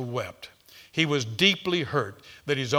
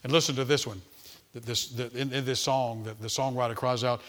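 normal talking voice

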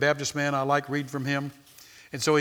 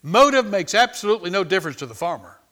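preaching; 4 semitones higher, 9 dB louder than usual. Acted. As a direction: drive the point home to the congregation. Preaching at full force.

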